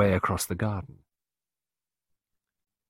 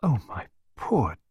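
slow, higher, pitying tone; tender murmur; 5 semitones higher than usual. A narrator's reading. Clarissa hugging sobbing Pippa, tender murmur. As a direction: comfort with drawn-out sympathy. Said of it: "Clarissa murmured".

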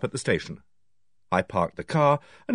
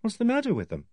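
surprised; 11 semitones higher, 4 dB quieter than usual. A narrator's reading.

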